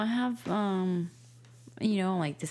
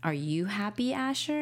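put-on higher, lilting question to a toddler; sing-song question; 6 semitones higher than usual. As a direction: coax the kid with a rising tune. Mom asks son in sing-song.

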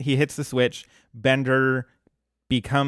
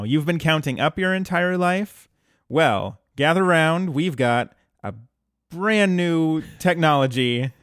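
hard sell ad; 2 semitones higher than usual. Pretend. Mock infomercial pitch.

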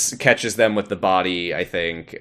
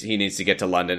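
usual speaking voice

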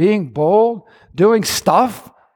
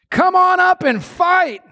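enemy challenge; 12 semitones higher than usual. Acted.

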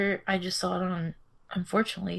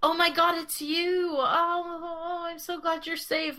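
fake tears; 10 semitones higher than usual. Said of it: "all the fake tears".

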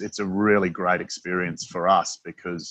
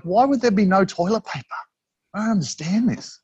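much higher, incredulous retelling of own confusion; baffled disbelief; 12 semitones higher, 4 dB louder than usual. Retold or imitated. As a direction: pitch shoots up in mock bewilderment. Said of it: "And I was like".

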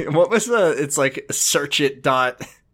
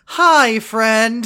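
high, loud sing-song greeting; mocking Lori; 8 semitones higher, 5 dB louder than usual. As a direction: chirp the hello like an overeager friend. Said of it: "Lori walks up to him and goes".

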